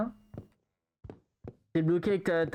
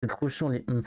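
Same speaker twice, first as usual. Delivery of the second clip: normal talking voice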